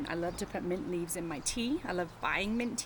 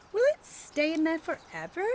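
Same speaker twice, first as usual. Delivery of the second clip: put-on higher, louder curious kid voice; Pete asking; 8 semitones higher, 5 dB louder than usual. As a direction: ask it wide-eyed like a small boy. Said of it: "Pete asks".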